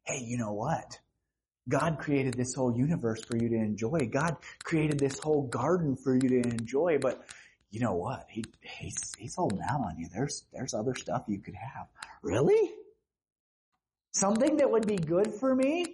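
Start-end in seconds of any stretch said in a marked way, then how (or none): 0.0-11.9 low, hushed conspiratorial tempter voice; sly tempter Satan. Acted. Satan tempting Eve in the garden, low and sly. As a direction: lean in and sell the secret. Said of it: "That's what Satan said".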